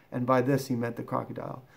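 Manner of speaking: ordinary delivery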